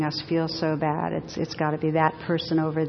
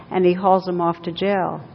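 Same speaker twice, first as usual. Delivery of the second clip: ordinary talk, nothing marked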